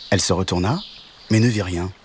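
usual speaking voice

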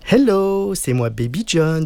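high "hello", slowing into put-on English; showy English-accented greeting; fake English accent. A narrator's reading. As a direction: announce yourself as an English boy. Boy posing as English.

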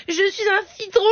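high lisping little voice; slushy lisp. Pretend. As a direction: lisp it like a shy lemon. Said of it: "il arrive même pas à dire qui il est".

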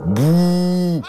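high, loud monster "Bouh!"; dragon's boo. Acted. Dragon scaring the knight, loud playful boo. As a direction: jump out and scare him. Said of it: "Il cria".

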